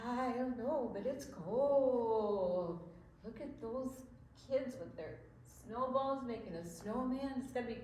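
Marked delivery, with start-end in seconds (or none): 0.9-2.9 drawn-out, slow mock shivering; pretend shiver. Grandma pretending to shiver in winter, slow shivery words. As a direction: act cold, slowly, with a shiver. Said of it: "I could tell from your shiver".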